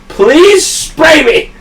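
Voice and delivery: high, slow, drawn-out begging yell; pleading shout. Retold or imitated. Covered in trash, begging coworker to spray her, loud slow plea. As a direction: beg loudly through the laughter. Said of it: "And I went".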